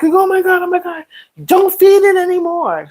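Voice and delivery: high, panicked pleading; freaked-out cry. Retold or imitated. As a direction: shout it in a panic as the raccoon eats. Family freaking out at raccoon.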